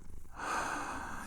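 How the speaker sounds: soft breathy sigh of contentment; content sigh. Acted. To the cuddled listener, a soft contented sigh. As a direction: let out a long happy sigh.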